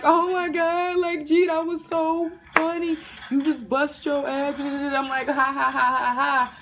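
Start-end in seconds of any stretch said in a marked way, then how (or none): 0.0-4.6 high, loud mocking girl voice; laughing teammates. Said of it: "These bitches are sitting over here laughing". 5.3-6.5 loud, high mock ha-ha-ha; forced fake laugh. Acts out her forced laugh.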